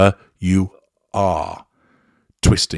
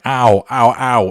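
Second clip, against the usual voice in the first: sharp pained "ow" cries, louder; 4 semitones higher, 5 dB louder, slower than usual. Retold or imitated. Victim crying out in pain as she is hurt, sharp yelps. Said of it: "The victim then responds going".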